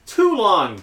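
"too" punched high and loud; stress on "too". Real, not acted. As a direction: lean hard into "too long". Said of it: "emphasis on own admission".

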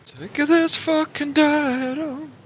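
high and slow mock whine. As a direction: parrot it back high and drawn out. Mocking the GM's line.

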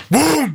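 imitated knock. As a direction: voice one sudden knock on the door.

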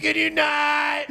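high, long-drawn petulant whine; bachelorette party passenger. Acted. Bachelorette to terrorist on the plane, exasperated. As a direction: stretch it out high in mock annoyance.

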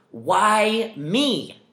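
high, drawn-out whine of self-pity; high whiny wail. Retold or imitated. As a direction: wail it like a wronged victim. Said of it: "question in your head keeps arising".

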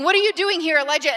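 booming call. Shown as a demonstration. Pastor replays God's first question to Elijah, loud and high. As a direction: ring it out like the mighty first calling. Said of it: "Did you hear that question twice at the beginning?".

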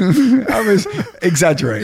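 laughing confession. Real, not acted. Confessing his exaggeration to the host while laughing. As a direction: chuckle it out, caught in the fib.

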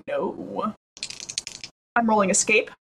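ordinary talk, nothing marked

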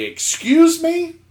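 high, slow, indignant rising question; exaggerated affronted query, drawn out. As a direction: act out taking loud offence. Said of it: "But actually, I say it very politely".

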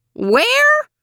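very high screechy question, rising; hard-of-hearing old lady's screech; screechy old woman. A narrator's reading. Old woman who cannot hear the man, high and screechy. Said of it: "It was high and screechy".

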